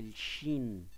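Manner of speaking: slow الشين, drawn out with an sh sound; voiceless "sh". Shown as a demonstration. Teacher contrasting two letter positions, الشين slowly with voiceless sh. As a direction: say الشين with a clear sh, no voicing. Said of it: "هنا بيتنطق حرف الشين".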